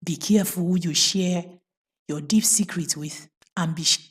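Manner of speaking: plain reading voice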